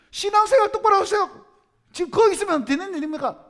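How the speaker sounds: very high, loud pretend scolding; mock angry shouting. Preacher demonstrating an outburst at his congregation, mock angry shout. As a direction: blast the congregation in a staged fit of anger. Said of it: "화를 내는 거야".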